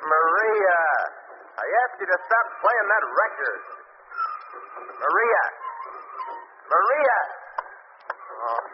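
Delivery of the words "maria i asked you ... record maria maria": calling out. Acted. Husband calling through locked door.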